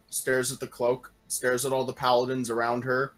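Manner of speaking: ordinary delivery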